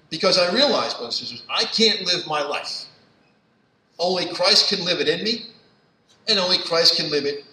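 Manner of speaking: loud, high, impassioned preaching; preaching at full force. Acted. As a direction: drive it home to the congregation. Preaches to the congregation.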